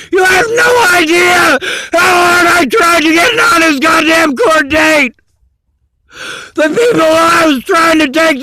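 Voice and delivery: real crying shout of despair; screaming and angry. Real, not acted. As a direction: yell it out, voice breaking into sobs. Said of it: "'cause I'm screaming and angry".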